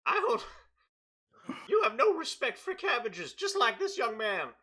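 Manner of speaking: high, loud scolding shopkeeper; squeaky rant at a customer; high scolding merchant. Acted.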